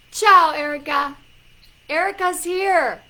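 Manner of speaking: loud, high-pitched announcement; excited greeting. Real, not acted. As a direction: greet a friend with delight. Announces Erica's arrival excitedly.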